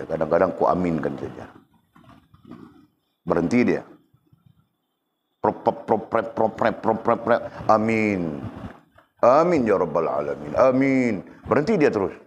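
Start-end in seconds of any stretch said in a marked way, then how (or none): 5.4-7.4 1 mumbled prayer noise. Retold or imitated. Mimics mumbling prayer under breath.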